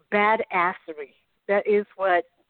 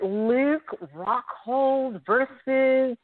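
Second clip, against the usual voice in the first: slow drawn out; 4 semitones higher, much slower than usual. Real, not acted. Stalling while reading the names.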